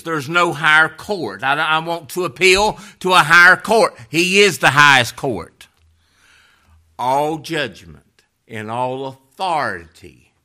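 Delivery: loud, high, impassioned pulpit cry; preaching at full force. Real, not acted. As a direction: cry it out to the whole congregation.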